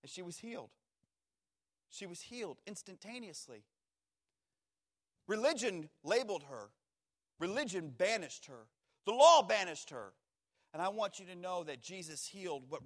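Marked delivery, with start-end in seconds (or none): none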